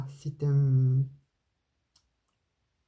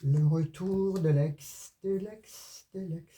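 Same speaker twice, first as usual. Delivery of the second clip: drawn-out title fading like an echo; mock echo; 3 dB quieter, slower than usual. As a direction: announce it slowly, then softer fading repeats. Playful echo announcing the next section. (écho théâtral qui s'éteint)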